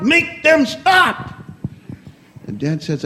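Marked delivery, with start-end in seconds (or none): 0.0-1.3 slow, high desperate shout; child shouting. Acted. Boy in his daddy's lap shouting at the stage, slow high yell. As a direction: draw out the plea as a frantic yell. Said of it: "Timmy jumped in his daddy's lap and said".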